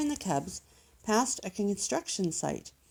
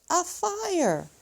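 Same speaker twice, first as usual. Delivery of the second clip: much higher, wide-eyed cry; excited cry; 10 semitones higher, 3 dB louder than usual. Acted. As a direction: point at the fire in alarm. Cubs see a fire.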